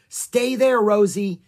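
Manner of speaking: high, loud command; crying out. A narrator's reading. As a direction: call down firmly and loudly. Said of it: "Annie cried".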